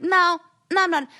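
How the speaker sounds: loud, high-pitched refusal; emphatic refusal. Acted. Acting out standing her ground now, emphatic refusal. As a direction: refuse forcefully, voice jumping up.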